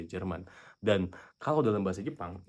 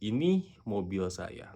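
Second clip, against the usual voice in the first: slow dictation; slow example sentence; much slower than usual. Shown as a demonstration. Teacher repeating the example slowly for viewers. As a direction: spell it out word by word. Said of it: "ada kalimat".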